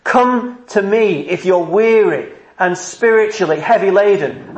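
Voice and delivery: crying out. Acted. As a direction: call sinners in with urgency, pitch held high. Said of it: "Do you hear him crying out saying".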